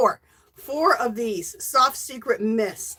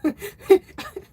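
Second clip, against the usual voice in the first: breathy laugh; 10 semitones higher, 4 dB louder than usual. Real, not acted. Laugh after coughing fit.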